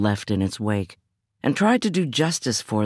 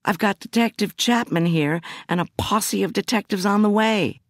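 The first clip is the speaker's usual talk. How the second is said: pitched higher, indignant and pushing back; bridling indignation; 8 semitones higher than usual. Acted. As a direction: bristle at being babysat. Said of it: "I bridled at the suggestion".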